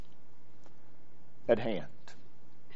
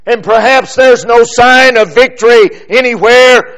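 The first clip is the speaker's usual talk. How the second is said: loud, higher, rising sermon climax with punched commands; full-force preaching; 7 semitones higher, 8 dB louder than usual. Acted. Preacher urging the congregation to pray without ceasing, at full preaching force. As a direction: preach it hard, hammering each "pray".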